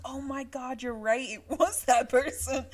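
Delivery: high, loud, laughing gasp of realization; surprised and laughing, voice jumping up; mock surprised realization. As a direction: act out the shock of finally getting it. Realizing who the killer was.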